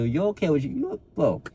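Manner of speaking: lightly voiced lazy inner voice; a hint of a sly buddy talking you out of it; tempting excuse voice. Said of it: "It's gonna tell you".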